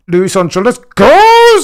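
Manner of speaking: high, loud cheering yell; excited cheer. Real, not acted. Fan cheering Lewis on against a Ferrari. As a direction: roar him on.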